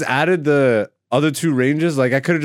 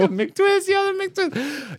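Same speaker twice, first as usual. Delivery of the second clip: very high, slow excited drawl; stoked skater drawl; much slower than usual. Real, not acted. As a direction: savor the trick name with glee. Nostalgic hype over old trick.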